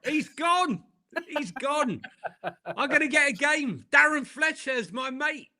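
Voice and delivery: high-pitched excited impression of a player; hammed-up delight, pitched high; mock gleeful celebration. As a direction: squeal it with laughing glee. Acts out Mainoo's delight at sacking.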